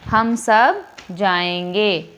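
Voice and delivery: slow, clear reading of हम सब जाएंगे with a full -एंगे ending; correct sentence demo. Shown as a demonstration. Teacher gives students the corrected sentence, read slowly. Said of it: "तो हम सब जाएगा नहीं".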